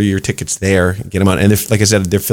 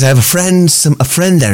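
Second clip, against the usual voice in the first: put-on Irish accent; 8 semitones higher than usual.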